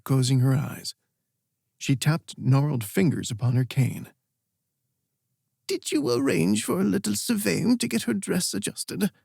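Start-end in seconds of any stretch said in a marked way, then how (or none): 5.7-9.1 put-on a much higher, cheery old woman's voice; a doting old queen asking a small favour. Old queen asks fondly.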